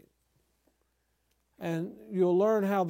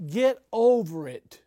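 slow, raised, louder rebuke; measured but forceful scolding; emphatic rebuke; 4 semitones higher, 6 dB louder, slower than usual. Acted. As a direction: draw it out firmly as a telling-off. Mock rebuke to self-important preachers.

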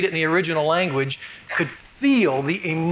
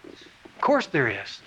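soft, slow, lower; quiet assurance; 5 semitones lower, 4 dB quieter, slower than usual. Shown as a demonstration. Preacher reassuring the congregation, soft and slow. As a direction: settle it gently. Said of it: "If there is any encouragement in Christ".

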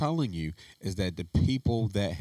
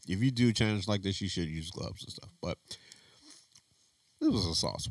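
ordinary talk

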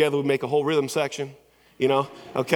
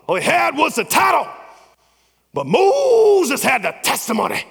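impersonating a fiery preacher; 12 semitones higher, 7 dB louder than usual. Retold or imitated. Pastor contrasting Pharaoh's title with Moses' testimony, shouted preaching. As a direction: build each title line up to a cry. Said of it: "But he goes".

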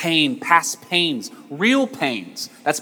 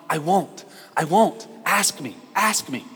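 higher, lightly voiced eager child; piping-up kid; 4 semitones higher than usual. Wronged kid pipes up to Timothy, lightly imitated eagerness. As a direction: urge him on like an eager little kid. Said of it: "pipes up and starts shaking his head and going".

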